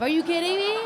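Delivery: loud, high disbelief; mock outrage. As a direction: act outraged at yourself. Mock outrage at own skill.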